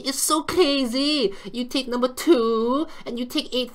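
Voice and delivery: high, loud, gleeful put-on voice; gloating trader character. Trader gloating to viewers, high gleeful put-on voice. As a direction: strut over a trading win in character.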